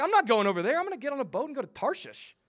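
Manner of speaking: loud, stubborn refusal; mock defiant Jonah. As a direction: dig in your heels like a sulky prophet. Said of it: "Jonah's like".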